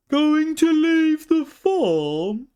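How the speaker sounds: high, slow, astonished cry; very surprised. A narrator's reading. Calf hearing the pony will leave, astonished. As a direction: gasp it out in disbelief. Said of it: "in a very surprised voice".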